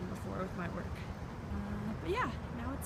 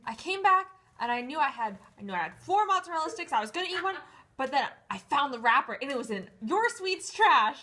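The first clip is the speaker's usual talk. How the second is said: mock outrage, pitched up and punching out the grievance; accusatory; 6 semitones higher, 9 dB louder than usual. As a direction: imitate an indignant accuser, higher and louder. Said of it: "Jamal was very accusatory".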